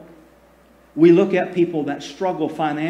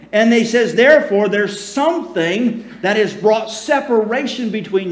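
raised, louder preaching voice; full-force preaching; 5 semitones higher, 5 dB louder than usual. Acted. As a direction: preach it out with fire to the congregation. Preaching Malachi's return to me.

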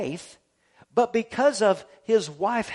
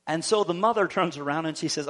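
ordinary talk

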